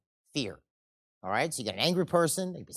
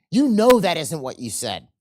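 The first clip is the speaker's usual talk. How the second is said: same words pushed louder, slow and hard; angry repeat, louder; louder accusation; 10 dB louder than usual. Acted. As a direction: press the accusation harder.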